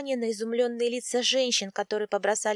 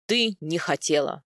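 low-pitched, angry bark; barked retort; 5 semitones lower than usual. A narrator's reading. Husband throwing his wife's excuse back, barking. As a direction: throw the words back angrily. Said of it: "рявкнул он".

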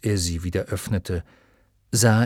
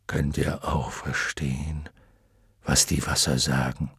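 dragon voice; 7 semitones lower, 4 dB quieter than usual. A narrator's reading. Luck dragon wondering aloud to his friends, low. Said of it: "Plötzlich hob Fuchur den Kopf".

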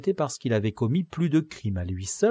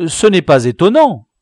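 louder and higher, urgent; raised pleading voice; 8 semitones higher, 13 dB louder than usual. Acted. Don Quichotte stopping the commissaire from striking, urgent and loud. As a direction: step in to stop the blow.

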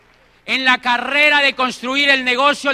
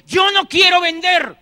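imitating a friend's loud, higher, slow protest; protesting shout; 7 semitones higher, 4 dB louder than usual. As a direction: shout it out like a stubborn friend refusing. Friend protests, voiced in story.

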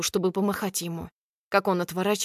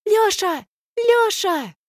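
high piercing squeal; shrieking; 12 semitones higher, 6 dB louder, slower than usual. A narrator's reading. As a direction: shriek his name. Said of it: "верещит Алька".

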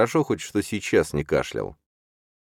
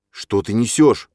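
loud, higher angry challenge; grated out; 7 semitones higher, 6 dB louder than usual. A narrator's reading. Числав answering his stepmother's lies, grating angrily. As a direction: force it out in outrage. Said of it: "проскрежетал Числав".

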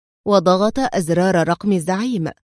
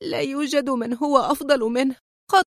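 crying harder; 5 semitones higher than usual. A narrator's reading. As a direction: let the tears take over, lightly acted. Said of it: "انهارت في مزيد من البكاء".

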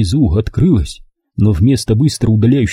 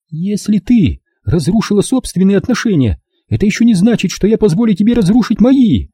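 high-pitched, firm retort; defiant daughter; 10 semitones higher than usual. Acted. Daughter refusing mother's meddling as she leaves, defiant. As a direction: warn her off in a raised pitch.

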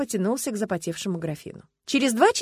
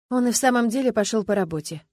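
ordinary delivery, nothing marked

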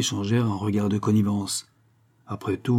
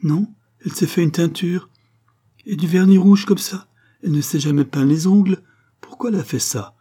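much higher and louder, upset; distressed outcry; 8 semitones higher, 7 dB louder than usual. Acted. Mother to the onlookers, upset high outcry. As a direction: cry out in disbelief. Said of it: "demanda-t-elle en interrogeant du regard".

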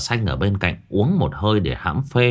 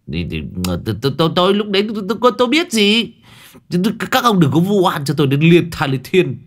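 much higher, fast stuttering tôi, tôi, tôi; flustered stammering protest; 9 semitones higher, faster than usual. Acted. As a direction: sputter in indignant denial. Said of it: "cậu bực bội dậm chân". (cậu Cẩn lắp bắp cãi, giọng rất cao và nhanh)

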